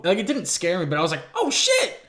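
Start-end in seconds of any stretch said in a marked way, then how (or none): 1.3-2.0 high, sharp startled cry; startled yelp. Retold or imitated. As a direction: yelp it out in fright. Startled quote while watching movie.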